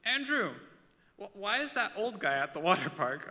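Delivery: put-on child loudly asking dad; loud kid question. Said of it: "quite loud".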